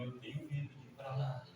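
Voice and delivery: very hushed, low murmur; retold fearful confession. Preacher voicing a crying man afraid of hell, hushed. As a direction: confess the fear almost under your breath. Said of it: "Ele chorando".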